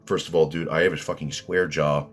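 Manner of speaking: normal talking voice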